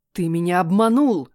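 king yelling at the deceiver from his throne. A narrator's reading. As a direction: bellow the accusation. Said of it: "завопил правитель".

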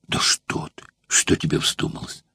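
whispered, waving off the question; hushed dismissal. Acted. Old actor waving off the courtesan, whispered. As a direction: breathe it out, unvoiced, brushing her off. Metrobius brushes off her question.

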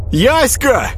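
high-pitched happy cry; joyful shout. A narrator's reading. Boy recognizing his sister, joyful cry. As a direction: call the name out with delight. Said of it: "радостно выкрикнул Святопол".

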